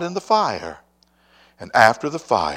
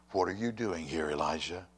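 much softer, hushed question; still small voice; 7 semitones lower, 12 dB quieter than usual. Retold or imitated. The still small voice of God asks Elijah, hushed. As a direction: ask it gently and low. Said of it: "a voice came to him and said".

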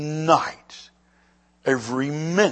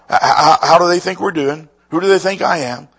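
loud, high, eager Jesus asking his friends; Jesus loudly asking the disciples how they're doing; Jesus voiced; 5 semitones higher, 9 dB louder than usual. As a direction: call out to the disciples like a pollster.

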